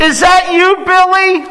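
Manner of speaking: high, loud call across the room. Retold or imitated. Voice calling across restaurant.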